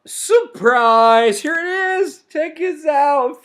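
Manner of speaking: high, loud, stretched-out announcement; big drawn-out showman's cry; excited reveal. Acted. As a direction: shout it like springing a surprise. Acting out a surprise reveal.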